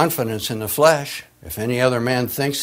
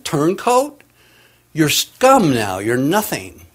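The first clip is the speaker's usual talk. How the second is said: scornful comrades; 4 semitones higher, 5 dB louder, slower than usual. Retold or imitated.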